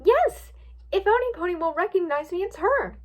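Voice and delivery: high, bursting delight; excited hope. A narrator's reading. Twilight sure Pinkie will recognize her, excited hope. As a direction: leap on it with relief.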